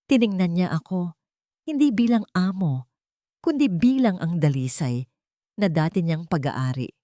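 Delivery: ordinary delivery, nothing marked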